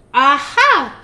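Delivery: loud high triumphant aha; shout. Acted. Witch shouting as she gets an idea. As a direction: leap on it with a shout. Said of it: "she shouted with a jump".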